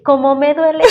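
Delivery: mother teasing her daughter in a gentle mock whine. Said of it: "Y después le digo".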